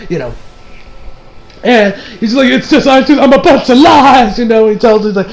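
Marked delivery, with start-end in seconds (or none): 2.5-4.4 mock confession. Acting out a panicked confession.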